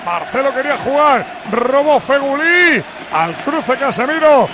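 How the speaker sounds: rapid football commentary with shouted peaks; live play-by-play. Real, not acted. Commentator calls Madrid's attack to listeners, fast urgent play-by-play. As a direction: follow the ball pass by pass, urgently.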